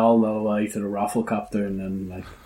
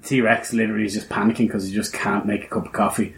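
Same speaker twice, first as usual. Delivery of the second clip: ordinary talk, nothing marked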